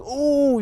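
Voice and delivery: high rising "ooh" of a cheering team; imitated crowd cheer. Boys reacting at basketball practice, imitated cheer. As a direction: mimic the boys' drawn-out whoop. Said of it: "this cheer".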